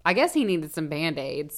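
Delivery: normal talking voice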